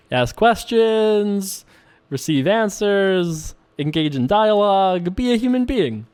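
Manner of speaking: each step spelled out slowly; slow mock instructions. Mock self-help guide spelling out the rules slowly.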